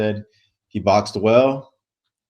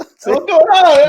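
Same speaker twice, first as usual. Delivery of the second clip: much higher and louder, slow stretched hype call; loud, high, drawn-out hello; excited greeting; 13 dB louder, much slower than usual. Real, not acted. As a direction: stretch it out like a show host kicking off. Show opening greeting to co-host.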